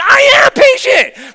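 very high, drawn-out angry scream; screamed retort. Retold or imitated. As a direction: re-enact losing your temper at mom. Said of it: "we would just have this back and forth".

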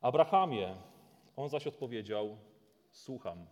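solemn dramatic Bible reading; scripture reading. Acted. Preacher reading Genesis to the congregation, solemn. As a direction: read the story with weight.

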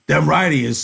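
loud, blunt ranch hand; brash Carlson. Acted. Carlson praising the horseshoe pitcher, loud and blunt. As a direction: bark it out like a bully.

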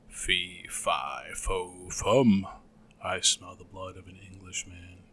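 low booming giant chant; dim booming ogre. A narrator's reading. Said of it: "cried out the ogre".

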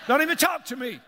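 high, loud huffy outburst; mock indignation. Preacher joking about his unnoticing wife, mock indignant. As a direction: snap it loudly in mock offence.

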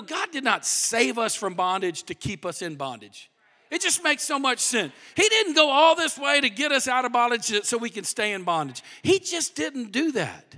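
usual speaking voice

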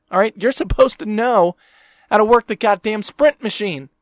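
venting at the phone store guy, heated and loud; angry rant. Real, not acted. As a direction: tear into the clueless worker. Ranting at Sprint worker.

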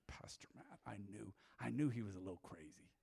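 soft, half-whispered gossip; mock whisper. Pastor voicing the congregation's judgement, mock whispered. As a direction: murmur it like churchgoers judging the pastor.

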